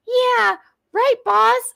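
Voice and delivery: very high mock-submissive voice; bullied co-host. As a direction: squeak out agreement to the boss. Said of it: "she bullies her into not laughing".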